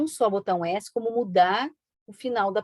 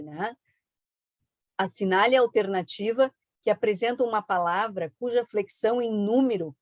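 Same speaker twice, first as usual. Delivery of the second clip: ordinary talk, nothing marked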